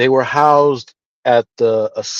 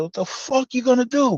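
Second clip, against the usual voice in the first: higher challenge thrown at a rival; mock angry challenge; 10 semitones higher than usual. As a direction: square up and throw it back, pitched up. Retold chow hall confrontation.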